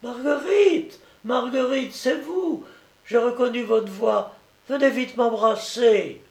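put-on high-pitched sick boy calling eagerly; a little boy's high, excited call; child calling out. A narrator's reading. Sick child wakes and calls to his visitor, calling out. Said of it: "qui se mit à crier".

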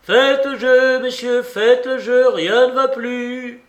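loud, high, ringing announcement; projected casino call; croupier's cry. A narrator's reading. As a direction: call it out across the table, drawing out the end. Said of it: "cria le croupier".

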